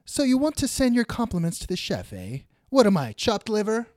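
high-pitched mock indignation; comic waiter taking the snub personally; offended waiter. Waiter complains to Ziggy at dinner, mock-offended. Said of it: "waiter says".

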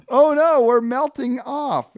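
high, loud comic wail; hammed-up alarm, stretching the end; mock-panicked Frank. Frank panics as the pizza melts, mock-alarmed cry. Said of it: "and then Frank goes".

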